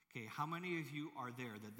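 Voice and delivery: ordinary talk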